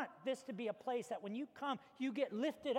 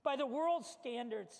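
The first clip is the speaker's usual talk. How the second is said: ordinary talk, nothing marked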